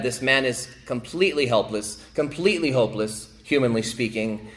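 usual speaking voice

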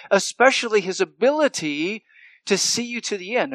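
normal talking voice

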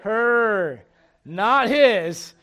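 "Her" said loud and pointed; stress on her. Real, not acted. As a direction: hit the word "Her" hard, then "Not his".

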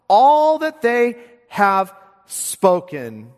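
very slow emphasis. Acted. Drawn-out quote of Jesus.

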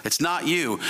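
ordinary talk, nothing marked

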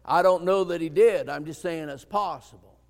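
ordinary delivery

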